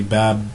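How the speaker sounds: "bab" closed on a silent-vowel b; sukoon ending. Shown as a demonstration. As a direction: stop "bab" dead on the final b. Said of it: "it becomes a silence after".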